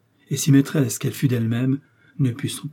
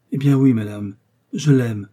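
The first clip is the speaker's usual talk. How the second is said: louder, falling declaration; defiant confession; 5 dB louder than usual. A narrator's reading. Novice confessing love to her rival, defiant. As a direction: own up forcefully.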